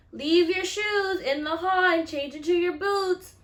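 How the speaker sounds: high, loud classroom call; teacher announcing. Acted. Teacher ordering kids before recess, loud high announcement. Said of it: "the teacher announces".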